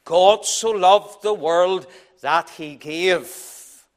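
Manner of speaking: very slow, stress on "loved", ending in a fall; slow and weighty. Shown as a demonstration. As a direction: draw out "God so loved the world that he gave" with deliberate weight. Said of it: "After the revelation comes the donation".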